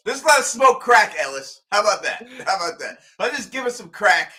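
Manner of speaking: mocking Ellis's plan, sarcastic and loud; sarcastic proposal. Real, not acted. Sarcastic suggestion.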